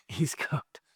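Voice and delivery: hushed amused aside; laughing whisper. Pretend. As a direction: breathe the punchline with a laugh. Punchline whispered after pause.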